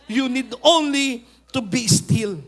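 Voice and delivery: solemn Moses quote. Acted. Preacher voicing Moses to the frightened crowd, slow and loud. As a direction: Moses steadies the people, spelling it out loud and slow.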